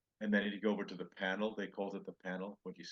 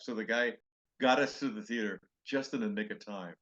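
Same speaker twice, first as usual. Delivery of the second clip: normal talking voice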